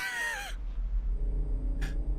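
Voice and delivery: high breathy shriek of laughter. Real, not acted. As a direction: burst into a squealing laugh. Laughing at the reversed scene.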